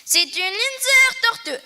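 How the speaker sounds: put-on high, slow, proud old lady voice; proud old Alsatian lady. A narrator's reading. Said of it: "expliquait Madame Schwarzenbaum avec fierté".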